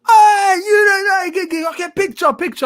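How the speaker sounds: high, loud, tumbling fan plea; hammed-up fan squealing for a picture, high and loud; overexcited fan. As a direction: beg for a photo all in a rush.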